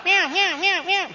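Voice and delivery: very high, loud kitten mews; meowing cat. Retold or imitated. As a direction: mimic a kitten yowling nonstop, loudly. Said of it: "I can hear the cat the whole way going".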